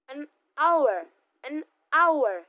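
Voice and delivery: an hour said as an awer, loud and clear; hour starts on the vowel, drop the h; silent h in hour. Shown as a demonstration. Teacher modelling an hour for students. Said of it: "Jadi seperti ada A".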